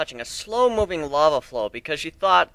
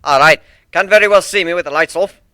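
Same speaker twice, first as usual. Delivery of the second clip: loud Scottish quip; Scottish minotaur; 4 semitones higher, 10 dB louder than usual. A narrator's reading. Minotaur jokes about the lights, Scottish. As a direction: tease them in a broad Scots voice. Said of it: "Scottish voice".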